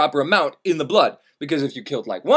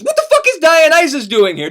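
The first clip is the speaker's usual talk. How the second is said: indignant; 9 semitones higher, 6 dB louder than usual. Real, not acted. Indignant outburst about Dionysus.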